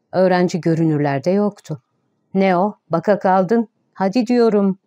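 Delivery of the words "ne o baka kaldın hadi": plain reading voice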